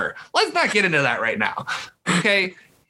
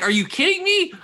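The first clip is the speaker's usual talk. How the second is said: incredulous outburst; 11 semitones higher than usual. Real, not acted. Incredulous rant about a hire.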